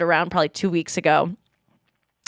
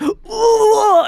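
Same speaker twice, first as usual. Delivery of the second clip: high, loud breathy ooh; mock climax moan; 13 semitones higher, 6 dB louder than usual. Host mimics the guy from the tweet, mock moan.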